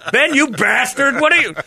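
cuss out a friend who scared you; indignant outburst. Retold or imitated. To his cop buddy after the prank, retold indignant yell.

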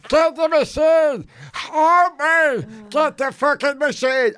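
high, slow, drawn-out comic wail; hammed-up desperate pleading, stretching every word; panicked cry for help. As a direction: wail as if winded and dying. Acts himself still wailing for help.

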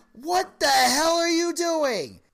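exasperated husband. Retold or imitated. Husband confronts wife over the talk, slow exasperated outburst. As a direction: stretch out a stunned, fed-up demand.

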